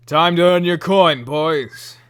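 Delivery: loud, high rallying yell to the gang; booming masked bandit's battle call; bandit leader's shout. Acted. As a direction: call out to your crew at full volume. Game master voices masked bandit leader.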